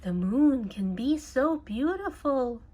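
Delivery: ordinary delivery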